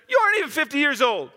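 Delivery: quick, high, disbelieving protest; incredulous crowd. Shown as a demonstration. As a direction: fling it back in disbelief. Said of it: "You need to put some inflection in it".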